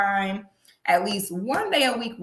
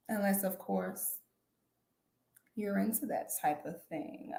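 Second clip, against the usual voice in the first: sultry hushed aside; 13 dB quieter than usual. Acted. Suggestive aside.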